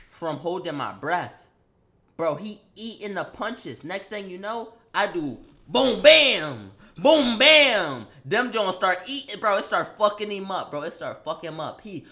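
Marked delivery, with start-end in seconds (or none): 4.9-8.1 loud boom bam combo; punch noises. Retold or imitated. Landing his punches, a loud boom bam. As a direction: rattle off the blows.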